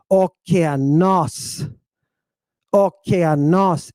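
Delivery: "okeanos" slow, accented syllable held twice as long; stressed accented syllable. Shown as a demonstration. Teacher giving the proper pronunciation, accented syllable stressed and lengthened. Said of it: "spend twice as much time on it".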